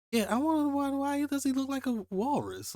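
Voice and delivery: can't get over the walrus look; baffled. Real, not acted. Reader amazed the principal looks like a walrus, high and baffled.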